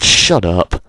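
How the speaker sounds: normal talking voice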